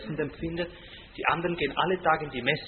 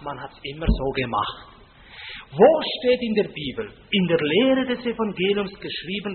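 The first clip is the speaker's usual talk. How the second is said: ordinary delivery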